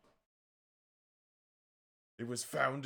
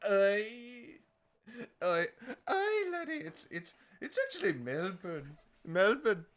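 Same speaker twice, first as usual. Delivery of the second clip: hammed-up Scottish laddie voice; a Scottish laddie, loud in spots; mock Scottish accent; 8 semitones higher, 5 dB louder, slower than usual. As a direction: keep the comic Scottish brogue going. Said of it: "that's Scottish".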